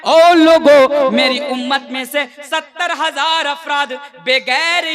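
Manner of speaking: fervent sermon voice, rising in bursts; preaching at full force. Acted. Preacher rousing the congregation about faith, fervent preaching. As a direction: preach it to the crowd with conviction.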